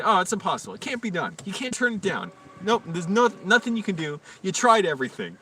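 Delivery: high, sarcastic mock despair; mock yelling. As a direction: exaggerate how impossible it supposedly is. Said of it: "I was just yelling at him".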